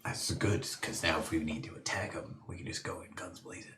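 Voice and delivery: huddle whisper. Real, not acted. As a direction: plot in a hush. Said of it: "I'm gonna whisper".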